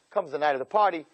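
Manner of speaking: normal talking voice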